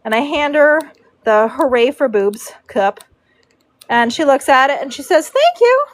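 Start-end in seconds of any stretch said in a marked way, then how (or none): 5.3-5.9 high, sweet and much louder; braider's saccharine thank-you for the joke cup, high and sweet; sugary thanks. Retold or imitated. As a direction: chirp it out with syrupy sweetness.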